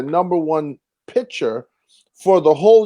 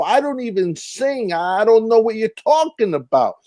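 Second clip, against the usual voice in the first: higher, puzzled put-on voice, retelling his answer; mock confused self; 7 semitones higher than usual. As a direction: play dumb about the show, pitched up. Retold confused reply to producers.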